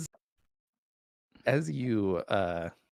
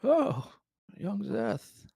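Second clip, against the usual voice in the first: put-on high, frail old man voice; quavering elderly king; 12 semitones higher than usual. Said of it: "the frail old man, Taravangian".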